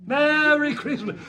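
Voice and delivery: high, loud and slow Merry Christmas; loud, slow festive shout. Shown as a demonstration. Comedian greeting the crowd, high, loud and slow. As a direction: belt out Merry Christmas slowly. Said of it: "I like it".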